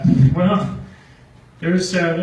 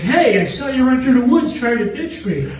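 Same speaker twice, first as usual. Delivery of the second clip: shuttle driver; 10 semitones higher, 8 dB louder than usual. Retold or imitated. Driver catches him fleeing.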